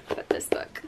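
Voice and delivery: hushed, secretive whisper; soft conspiratorial whisper. Acted. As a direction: lean in and breathe it. Hushed aside about beloved book.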